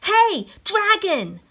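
very loud, high, slow yell. Acted. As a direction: yell right into his ear. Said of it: "She shouted as loud as she could".

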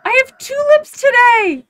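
real high, loud burst of joy; excited squeal. Real, not acted. Gamer discovers tulips grew in the game, excited high shout. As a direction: shriek with delight at a surprise.

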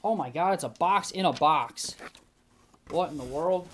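high, loud amazed surprise; startled by a box inside a box; surprised. Real, not acted. Opens a box in a box.

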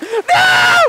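high shrieking laugh; real panicked shriek-laugh. Real, not acted. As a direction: let out a frantic squealing laugh. Frantic moment in game.

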